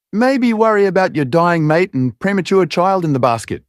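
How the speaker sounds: high, cool woman's voice; a sharp woman mocking him. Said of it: "the woman's voice said".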